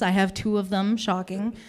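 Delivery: ordinary talk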